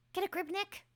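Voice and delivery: high-pitched, scolding sidekick; girl assistant. Acted. Assistant telling lawyer to pull together, high urgent voice. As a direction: snap him out of it, high voice.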